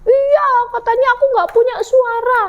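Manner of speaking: high, plaintive little chick; tearful. Acted. Ciki complains to parents, high tearful whine. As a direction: whine it out as if crying.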